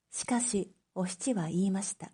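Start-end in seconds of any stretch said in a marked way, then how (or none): none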